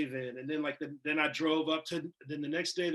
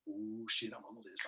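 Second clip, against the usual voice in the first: soft and low, stunned; quietly stunned at good news; hushed awe; 6 semitones lower, 11 dB quieter than usual. Retold or imitated. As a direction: say it under your breath in disbelief. Acting his awed reaction.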